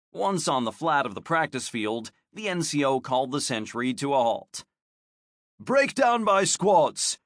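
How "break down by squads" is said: slow, higher barked order; commanding. A narrator's reading. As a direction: direct the squads firmly. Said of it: "Alario directed".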